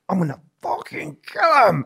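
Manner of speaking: recalling fury at his trainer; retold fury. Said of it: "I was so pissed".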